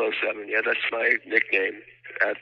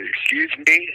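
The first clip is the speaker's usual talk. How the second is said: indignant excuse me; 8 semitones higher, 4 dB louder than usual. Retold or imitated. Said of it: "I said".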